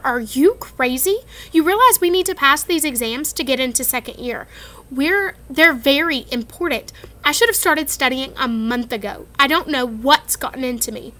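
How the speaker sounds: exasperated. Acted. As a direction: scold him like he's mad. Hermione exasperated about exams.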